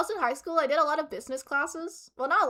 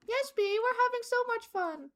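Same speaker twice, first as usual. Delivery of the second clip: forced cheerful hostage; 8 semitones higher, 3 dB quieter than usual. Pretend. Voicing hostage viewers.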